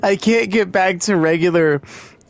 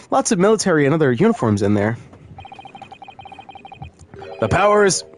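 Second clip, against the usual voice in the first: usual speaking voice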